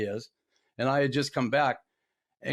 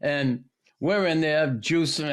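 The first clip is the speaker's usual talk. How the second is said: ordinary talk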